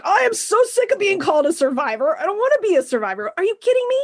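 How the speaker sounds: exasperated high-pitched rant, quick, words tumbling out; reliving a furious outburst at a friend, high and fast; fed-up outburst, pitched well up. Retold or imitated. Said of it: "I lost my shit on this man".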